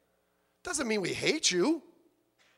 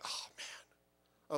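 breathy dismayed whisper; 16 dB quieter than usual. Retold or imitated. Dismayed reaction to dad's lie order.

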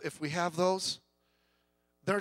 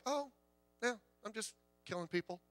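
chillingly casual; 5 semitones higher, 8 dB quieter than usual. Retold or imitated. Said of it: "very calmly".

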